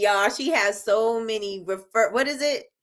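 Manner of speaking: usual speaking voice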